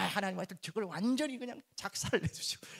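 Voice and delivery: mock vengeful prayer. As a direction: parody a spiteful prayer. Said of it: "이게 아닙니다".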